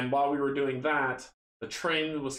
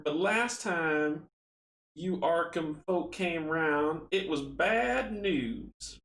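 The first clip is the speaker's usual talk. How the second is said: put-on higher, drawn-out Southern country accent; 6 semitones higher, slower than usual. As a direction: play a villager with a slow, lilting Southern drawl. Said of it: "I gotta do my country accent".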